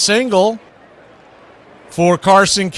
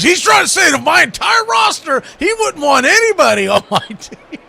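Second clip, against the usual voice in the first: outraged retelling; 12 semitones higher than usual. Retold or imitated. Yankee fan outraged about his whole roster, high laughing shout. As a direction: imitate the friend's outrage, laughing.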